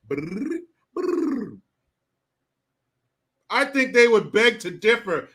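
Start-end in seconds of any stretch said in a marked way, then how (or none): 0.1-1.6 trilled brrr like mallets running over bars; imitated xylophone. Shown as a demonstration. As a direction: roll a quick, ringing xylophone trill. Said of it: "it sounds like a xylophone".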